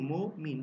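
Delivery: মো মিন split into two beats; মমিন শব্দটি দুই অক্ষরে ভেঙে; syllable by syllable. Shown as a demonstration. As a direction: say মো, pause, then মিন. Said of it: "মো একটু উচ্চারণ করছি সংক্ষিপ্ত আকারে".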